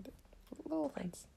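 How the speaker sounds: sly stage whisper; whispered aside. Real, not acted. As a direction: drop to a teasing whisper. Joking aside about America.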